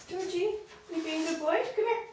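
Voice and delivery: high-pitched coaxing of a pet; pet talk. Real, not acted.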